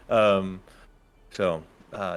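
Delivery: usual speaking voice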